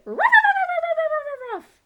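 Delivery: very high, very loud ruff ruff barks; fierce barking. Acted. Poo Poo barking at the squirrel in the tree. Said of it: "barking fiercely".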